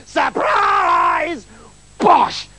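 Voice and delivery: very high, stretched witch's screech. A narrator's reading. Witch springs her trap on the prince, high screech. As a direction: screech it in triumph, then punch the blow. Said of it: "she screeched".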